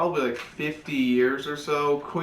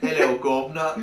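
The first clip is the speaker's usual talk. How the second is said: bad British accent. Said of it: "I can't do a British accent".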